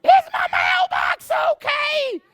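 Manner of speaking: very high, loud, drawn-out shriek; frantic screaming. Retold or imitated. Lady bursting out of her house at a crashed rider, screaming. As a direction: scream each word slowly, imitating a frantic woman. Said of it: "she just starts screaming".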